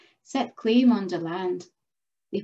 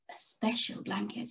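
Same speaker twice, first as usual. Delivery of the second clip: ordinary delivery, nothing marked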